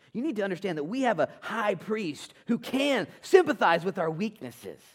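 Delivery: high, loud, driving sermon voice; fired-up pulpit delivery; full-force preaching. Real, not acted.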